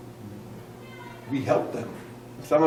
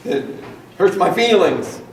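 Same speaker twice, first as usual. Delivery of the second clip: loud, high "hurts my feelings"; teasing the congregation in a mock whine; 5 semitones higher, 7 dB louder than usual. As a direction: play the wounded giver. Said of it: "I know sometimes we say".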